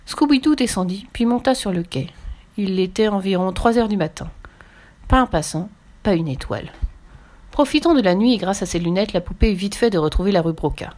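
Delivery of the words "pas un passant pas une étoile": ordinary delivery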